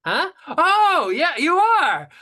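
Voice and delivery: high, loud fake realization; hammed-up dawning surprise, pitched very high; mock surprise. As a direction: squeal it like a sudden realization. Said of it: "And I was like".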